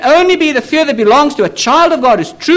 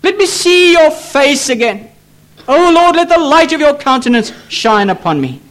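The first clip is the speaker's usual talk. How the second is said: imploring prayer; 5 semitones higher than usual. Retold or imitated. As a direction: pray aloud as the pleading psalmist. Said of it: "he prays".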